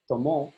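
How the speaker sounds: "Tomo" with the final mo stressed; stress on last syllable. Shown as a demonstration. Teacher shows the he-drank form, stress on MO in "Tomo". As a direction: land on MO in "Tomo". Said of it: "That means he or she drank".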